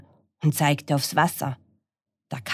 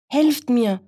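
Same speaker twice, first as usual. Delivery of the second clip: high, louder plea for help; gasping dog; 9 semitones higher, 5 dB louder than usual. Acted. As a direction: gasp it out like a tired dog. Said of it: "japste der Hund".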